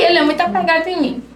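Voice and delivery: usual speaking voice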